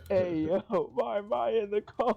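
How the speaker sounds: laughing, high-voiced objection; incredulous high squawk; high-pitched protest. Real, not acted. Player protests being hit by cone.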